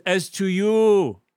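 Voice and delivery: drawn-out heartfelt proclamation; solemn declaration. Acted. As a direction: declare it slowly to the migrants. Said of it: "He says".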